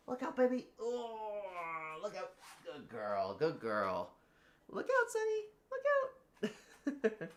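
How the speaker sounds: very high, soft cooing. Real, not acted. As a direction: squeal softly at the puppy. Baby talk to dog.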